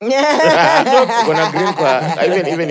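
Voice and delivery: giggling through the words; laughing. Real, not acted. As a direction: laugh as you speak. Laughing while speaking.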